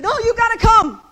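put-on high, loud urging from the old woman; little old lady demanding he come; insistent old lady. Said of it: "She said".

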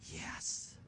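a faint whispered yes. Retold or imitated. Dying friend answering the preacher in a faint whisper. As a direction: breathe the yes out. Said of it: "She said".

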